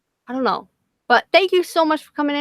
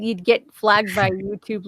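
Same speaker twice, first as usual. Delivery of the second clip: normal talking voice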